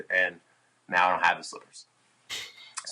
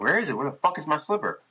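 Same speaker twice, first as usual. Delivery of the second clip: retold panic; 6 semitones higher, faster than usual. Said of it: "I'm starting to freak out".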